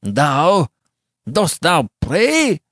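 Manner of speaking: loud, high, slow incredulous mockery; fiendish scorn. A narrator's reading. Giovanni mocking Beatrice's prayer, fiendishly scornful. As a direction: draw out the question with contempt. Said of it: "still with the same fiendish scorn".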